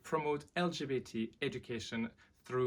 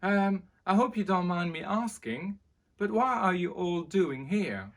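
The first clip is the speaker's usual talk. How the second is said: higher, louder, nervous prince; young prince voice; 8 semitones higher, 9 dB louder than usual. Acted. Prince Henry greeting the crowd outside, higher and nervous. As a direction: greet the crowd a little unsure. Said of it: "said Henry".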